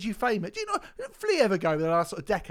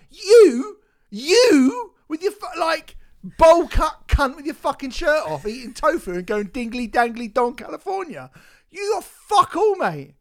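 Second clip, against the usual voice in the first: much louder, higher yelling insults; furious shout; 8 semitones higher, 9 dB louder than usual. Real, not acted. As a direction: tear into him at full volume. Furious rant at the singer.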